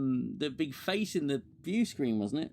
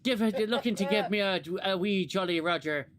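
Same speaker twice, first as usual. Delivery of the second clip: mock Irish accent; 4 semitones higher, 3 dB louder than usual. Mocking Irish colonist flirting with Riker.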